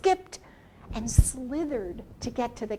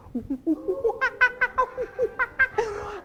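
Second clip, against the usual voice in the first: high hooting monkey laugh; monkey talk laugh; 10 semitones higher, 3 dB louder than usual. Shown as a demonstration. Storyteller demonstrating monkey talk for children, high hooting laugh. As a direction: show the kids a monkey laugh. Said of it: "Let me hear some monkey talk".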